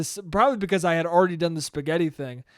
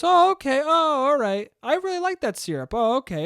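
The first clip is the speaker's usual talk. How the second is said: higher-pitched mock-polite mom reply; placating mom; 8 semitones higher, 3 dB louder than usual. Friend's mom answering the kid about her syrup, placating. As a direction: lightly imitate a mom letting it go, pitch jumping up.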